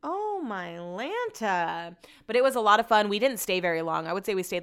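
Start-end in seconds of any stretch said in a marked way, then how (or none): 0.0-2.0 drawn-out exasperation. Real, not acted. Exasperated exclamation.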